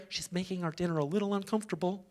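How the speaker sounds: hushed, quick aside; confidential complaint. Acted. Disciples complaining privately to Jesus about her, hushed grumble. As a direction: drop to a low, hurried murmur.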